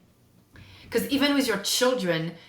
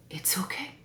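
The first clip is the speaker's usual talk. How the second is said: soft whisper; 8 dB quieter than usual. Acted. Reassuring oneself about feelings.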